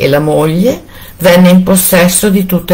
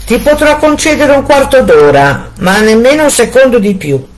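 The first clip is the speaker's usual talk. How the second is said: higher, hard villain's voice; cold Bluebeard; 8 semitones higher than usual. A narrator's reading. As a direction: grant the quarter hour like a sentence. Bluebeard answers his wife.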